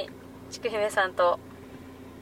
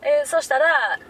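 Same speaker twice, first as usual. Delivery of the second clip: ordinary talk, nothing marked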